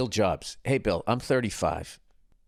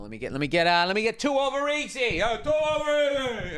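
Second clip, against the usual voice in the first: diner cook calling orders; 9 semitones higher than usual. Retold or imitated. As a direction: call the order across the grill. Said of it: "You know, imitating diner order".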